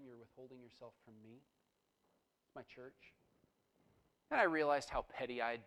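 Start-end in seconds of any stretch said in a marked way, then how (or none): 0.0-3.1 softer, hushed and tender; gentle, quiet voice of the Spirit. Retold or imitated. The Holy Spirit gently correcting the preacher, soft and hushed. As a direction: lean in and say it gently, barely above a murmur. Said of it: "very carefully, very gently say".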